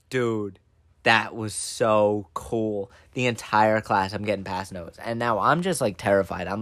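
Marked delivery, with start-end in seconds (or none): 0.1-2.9 low, slow, deadpan awe; drawn-out, quietly impressed; hushed awe. Retold or imitated. Classmates' notes praising the prankster, slow low awe.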